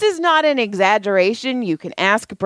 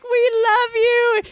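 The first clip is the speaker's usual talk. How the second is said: very high, drawn-out gush; mock gushing; slower than usual. Friends toppling the one who felt unloved, gushing. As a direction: imitate friends gushing, slow and high-pitched. Retold friends piling on with affection.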